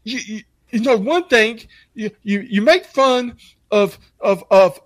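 high, stammering imitation; exaggerated wounded indignation; mock offended. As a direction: stumble over it like an upset kid. Imitates offended young co-worker.

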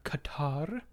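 a playful whispered reveal. Acted. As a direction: drop to a whisper for the place name. Whispering the race location.

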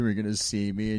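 usual speaking voice

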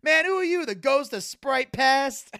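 high, loud, stretched-out heckle; mock indignant challenge. Hospital patient to the ghost, loud mock indignation.

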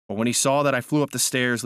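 ordinary delivery, nothing marked

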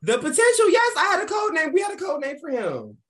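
high, loud and excited; excited shout. Real, not acted. Excited shouting about code name.

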